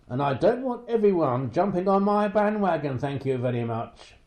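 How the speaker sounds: acted old man's testy protest; indignant. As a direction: put them firmly in their place, loudly. Old man rebuffs the reporter.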